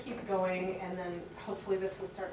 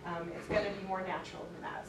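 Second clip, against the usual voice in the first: ordinary delivery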